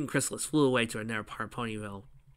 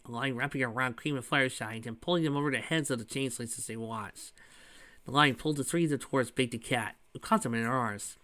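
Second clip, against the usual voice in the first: usual speaking voice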